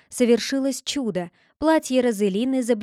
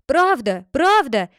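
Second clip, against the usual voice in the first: loud urgent cry of agreement; shouting; 4 semitones higher, 6 dB louder, slower than usual. Acted. Katani shouting to the judge in the girl's defence. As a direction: call it out to the judge. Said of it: "закричал Катани".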